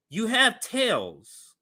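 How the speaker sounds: normal talking voice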